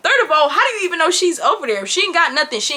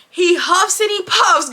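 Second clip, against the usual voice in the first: slow, high storybook rhythm; storybook cadence; 5 semitones higher, slower than usual. Retold or imitated. Woman describing her date's huffing, in storybook cadence. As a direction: lilt it like a children's rhyme. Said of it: "sounding like a damn Doctor Seuss book".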